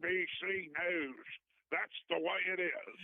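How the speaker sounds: lightly voiced evening news anchor; Walter Cronkite anchor voice. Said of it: "that's a very nice accent there".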